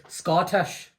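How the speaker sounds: "Scottish" in a Scots accent, rising at the end. Shown as a demonstration. Host shows his guest how to say it, Scottish accent on "Scottish". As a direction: say "Scottish" like a Scot, lifting the ending. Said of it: "if you wanted Scottish".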